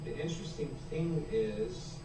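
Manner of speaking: faint delayed echo of the stream; distant playback through a speaker; from another room. Real, not acted. Own stream audio echoing back.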